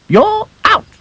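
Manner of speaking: high squeaky insisting; insistent high voice. Acted. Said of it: "insisted Dotty".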